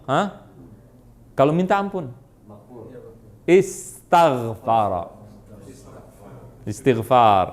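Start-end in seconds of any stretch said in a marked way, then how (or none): 3.5-7.5 "Istaghfara" stretched very slowly, lower; sounding it out. Shown as a demonstration. Teacher giving students the form for asking forgiveness, slowly sounding out "Istaghfara". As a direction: draw out each part of "Istaghfara" for students.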